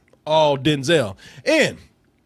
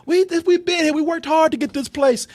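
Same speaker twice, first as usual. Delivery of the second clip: townsfolk plea; 10 semitones higher than usual. Retold or imitated. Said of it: "these people saying".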